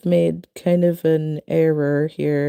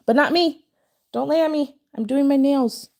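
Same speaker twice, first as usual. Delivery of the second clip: raised, higher scolding; scolding the cat; 6 semitones higher, 4 dB louder than usual. Real, not acted. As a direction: shoo the cat off firmly. Telling the cat off.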